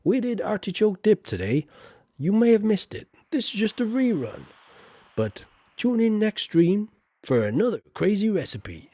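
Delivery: mock TV cooking show plug. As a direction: pitch it like a cooking show promo. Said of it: "just an advert for the King of Sauce".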